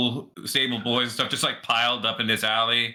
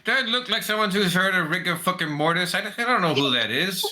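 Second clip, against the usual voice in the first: rude rat, baffled; 7 semitones higher than usual. Acted. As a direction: answer as a cranky, clueless rat.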